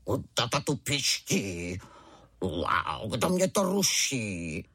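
low, growly tiger voice; rumbling roar at being disturbed; tiger cub roar. A narrator's reading. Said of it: "zařval divné jako tatínek".

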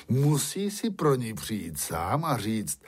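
plain reading voice

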